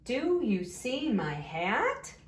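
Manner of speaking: slow question, HAT lifting upward; question rise on HAT. Shown as a demonstration. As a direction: read it slowly with the voice up on HAT. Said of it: "your voice is going up at the end".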